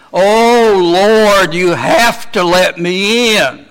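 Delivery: a preacher mimicking a self-satisfied churchgoer at heaven's gate; self-righteous pleading. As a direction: ham up a smug believer demanding entry. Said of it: "I'm not gonna be able to stand and say".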